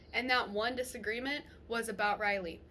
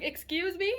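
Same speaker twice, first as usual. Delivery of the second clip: high-pitched mock affront; retold indignant challenge; 8 semitones higher, 4 dB louder than usual. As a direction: re-enact calling out a boyfriend's backtalk. Acted comeback to his backtalk.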